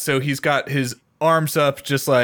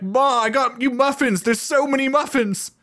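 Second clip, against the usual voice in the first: much higher, eager offering voice; eager Garrett; 11 semitones higher than usual. Acted. Garrett offering Ma muffins, high and eager. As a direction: offer it up brightly like a gift. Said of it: "and he's like".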